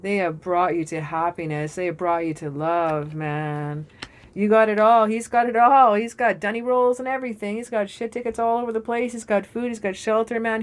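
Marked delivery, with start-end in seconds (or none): 4.3-10.6 high-pitched, fired-up listing; excited hype. Real, not acted. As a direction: start loud and keep it high and thrilled. Hyping up the Emperor's supplies.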